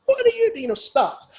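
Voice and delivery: high, loud acted taunt; mocking, high-pitched scolding; merciless mocking. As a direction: jeer at a flinching coward. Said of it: "makes fun of him mercilessly".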